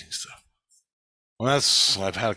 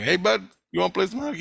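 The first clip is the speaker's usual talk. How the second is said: higher, quick, eager invite; chirpy imitated invite, quicker than the first; high friendly ask; 9 semitones higher, faster than usual. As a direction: pipe up brightly, fast and keen. Asks Oilers fan again.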